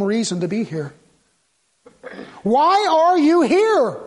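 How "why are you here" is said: loud, high, drawn-out demand; preacher's raised, slow challenge; forceful challenge. Real, not acted. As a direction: hammer each word slowly at the crowd. Challenges congregation with the question.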